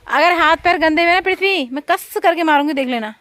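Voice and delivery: scolding threat. Real, not acted. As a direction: warn him sharply before he gets dirty. Mother threatens child. (बेटे को ऊँची आवाज़ में डाँटती माँ)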